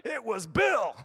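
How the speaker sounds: high, loud, slow sulky whine; whiny childlike complaint. Retold or imitated. Believer whining to the Father about Christians: high, slow, loud. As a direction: drag it out like a pouting child.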